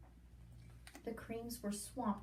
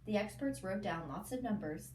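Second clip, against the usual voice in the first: usual speaking voice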